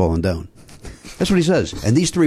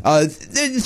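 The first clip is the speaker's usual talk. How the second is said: usual speaking voice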